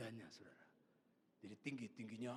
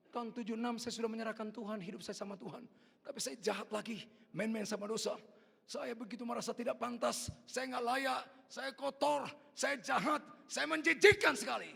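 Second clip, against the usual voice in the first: raised pitch, growing to loud self-reproach; anguished confession, building; 7 semitones higher, 8 dB louder than usual. Retold or imitated. Sinner confessing unworthiness to God, building to a cry. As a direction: pile up each admission louder.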